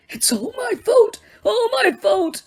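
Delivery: high, crying confession; old man sobbing in guilt. Acted. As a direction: sob it out. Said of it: "he sobbed".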